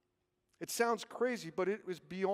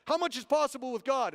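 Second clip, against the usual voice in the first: high, raised preacher call-out; imitated preacher; 6 semitones higher, 5 dB louder than usual. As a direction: challenge the congregation like a preacher. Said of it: "when he preached one day".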